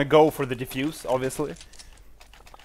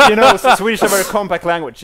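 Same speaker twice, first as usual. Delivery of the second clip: laughing; 5 semitones higher, 9 dB louder, faster than usual. Real, not acted.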